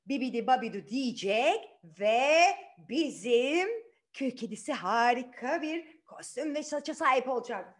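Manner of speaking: usual speaking voice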